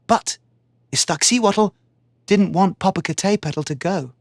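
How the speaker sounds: plain reading voice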